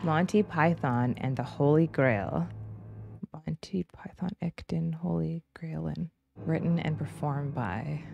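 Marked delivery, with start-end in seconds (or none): 3.3-8.0 breathy whispered title read; hushed, unvoiced credit read. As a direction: whisper it like a secret.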